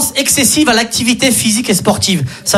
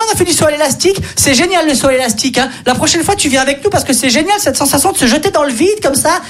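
higher, gushing thrill-seeker voice; higher mock excitement about the jump; thrilled friend; 5 semitones higher than usual. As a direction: gush about bungee jumping to win him over. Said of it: "et qui a dit".

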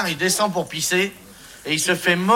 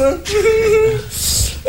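giggle. Real, not acted. Giggles during own riddle.

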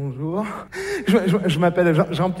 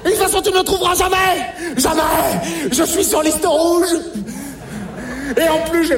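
taunting shout; 7 semitones higher than usual. Acted. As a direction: jeer at him down the phone. Taunts alien on the phone.

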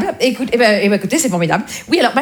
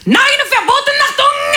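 put-on high-pitched shouted German accent; mock German shout. Strict household forbidding all other music, high mock-German shouting. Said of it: "c'est mon accent vosgien qui remonte".

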